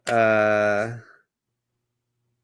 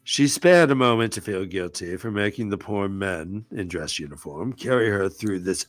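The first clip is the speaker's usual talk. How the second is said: lower-pitched pretentious British reading; 6 semitones lower than usual. Acted. Host reading the book aloud, pretentious British accent. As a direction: read it as a pompous Brit. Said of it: "in my pretentious British voice".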